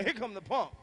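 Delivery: usual speaking voice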